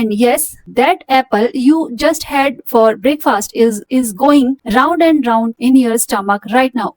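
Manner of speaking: slow, careful reading to a class; measured textbook reading; slow read-aloud. Acted. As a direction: read it out slowly for students. Teacher reads English text aloud.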